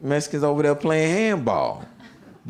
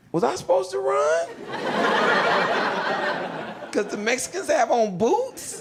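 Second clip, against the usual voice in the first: high-pitched, incredulous questioning; mock bewilderment; 6 semitones higher than usual. New inmate baffled by why the dude ran, incredulous. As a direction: squeak it out in comic disbelief.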